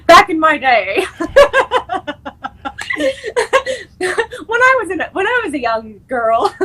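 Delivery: put-on high, laughing old woman voice; old lady voice. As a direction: play an old granny reminiscing. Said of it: "I talk like I'm, like, seven years old".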